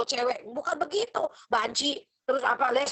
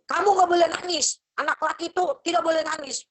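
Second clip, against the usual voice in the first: parent's scolding voice, higher and louder; 6 semitones higher, 5 dB louder than usual. Retold or imitated. Parent scolding a crying son, sharply raised voice. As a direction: scold the little boy for crying. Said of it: "Misalnya, anak laki gitu".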